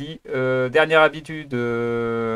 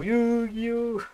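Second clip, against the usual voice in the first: high, long-held mock anime title call; drawn-out parody of a show title; mock title cry; 8 semitones higher than usual. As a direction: stretch each syllable out high, without shouting. Mimics the scene's sudden title cry.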